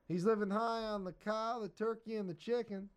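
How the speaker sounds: high, folksy country voices; rustic banter at the post office; hillbilly characters. Acted. Postman voiced in comic.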